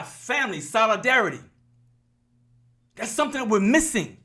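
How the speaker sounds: high, forceful preaching voice; preaching yell. Real, not acted. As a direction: preach it full force to the crowd. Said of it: "yelled at y'all enough".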